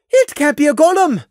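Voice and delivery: high, loud panicky insistence; acted frantic denial; panicked. A narrator's reading. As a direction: insist on it, close to hysteria. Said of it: "sounding panicked".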